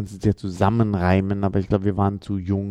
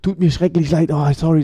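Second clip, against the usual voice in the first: flustered apology; 6 semitones higher, faster than usual. Retold or imitated. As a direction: gush out apologies, tripping over yourself.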